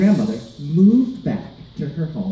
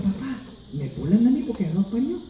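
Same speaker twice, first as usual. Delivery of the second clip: put-on high, quick kid's voice; complaining child; 7 semitones higher, 4 dB quieter, faster than usual. Teased kid telling dad at home, high quick complaint. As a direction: tattle fast and high to a parent. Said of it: "I went home and said".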